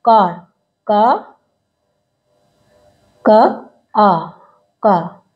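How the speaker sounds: कार broken into का, क, आ, का; letter sounds of कार; sounding out कार. Shown as a demonstration. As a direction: drag the आ out in कार. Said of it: "आ बोला जा रहा है इसको".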